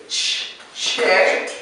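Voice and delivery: ch- cut off, then check with a hissed ch; teacher models the ch sound slowly. Shown as a demonstration. Teacher sounding out ch for a student, split ch. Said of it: "you have to say".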